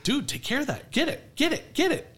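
high-pitched, insistent prodding; urgent urging. Retold or imitated. Friend urging his buddy to grab the spider, urgent and high. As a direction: urge a friend on, pitch climbing with each repeat. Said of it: "I'm like".

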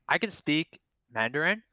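usual speaking voice

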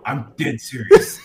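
low, slow deadpan retort; imitating a dead-serious brother. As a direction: drop low and say it slowly, dead in his face. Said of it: "dead in his face".

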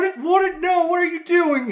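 high loud sputtering questions; flustered girlfriend. Retold or imitated. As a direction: mimic her stammering in alarm. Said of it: "It's like".

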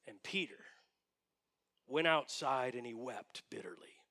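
low, hushed scripture reading fading to breath; grave near-whisper at the end; hushed and solemn. Real, not acted. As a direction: let the sorrow land softly. Preacher hushes for Peter's weeping.

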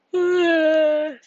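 big loud, high yawn; involuntary high-pitched yawn; real yawn. Real, not acted. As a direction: let a long yawn escape mid-recording. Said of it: "I just yawned".

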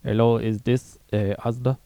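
put-on Indian accent, loud opening; phone caller in a mock Indian accent. Customer ringing Asda, in a put-on Indian accent. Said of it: "We'll have to do an Indian".

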